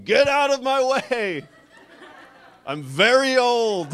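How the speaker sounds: put-on high, loud, slow cranky old-man shout; old man yelling. Old man in a wheelchair clearing the airport terminal, laughing shout. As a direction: bellow like a furious old man swinging a cane. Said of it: "he was yelling".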